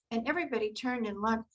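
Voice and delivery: usual speaking voice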